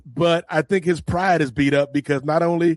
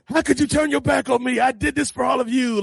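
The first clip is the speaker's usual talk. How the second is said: mock yell; 8 semitones higher than usual. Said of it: "he's yelling at the people".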